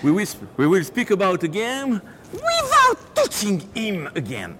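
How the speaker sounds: high, slow put-on English voice; mock English announcer. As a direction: proclaim it like a hammy host. English aside, hands-off joke. (voix anglaise exagérée)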